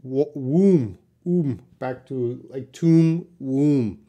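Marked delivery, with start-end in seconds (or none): 0.3-1.6 "womb" with a long oo, B silent; silent-B demo. Shown as a demonstration. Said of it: "the B is silent". 2.7-4.0 "tomb" and "womb" with the same oo, B silent; rhyming-vowel demo. Shown as a demonstration. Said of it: "the B is silent".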